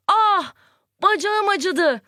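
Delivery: high, slow cry of sudden pain. Shown as a demonstration. Teacher acting a hurt leg for the class, pained cry. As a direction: yelp as if the leg just got hurt. Teacher acts out exclamation example.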